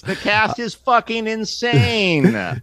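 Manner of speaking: high, drawn-out gushing yell; wildly excited. Real, not acted. Raving to co-hosts about the cast, wildly excited. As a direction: stretch it out high in thrilled disbelief.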